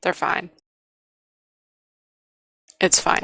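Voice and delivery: put-on flat, clipped teen answer; sullen monotone. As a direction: give the bare minimum, no interest. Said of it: "in a complete monotone".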